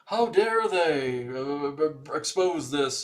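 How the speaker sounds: hammed-up indignation, stretched out; mock outraged Democrats. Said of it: "how the Democrats react to that".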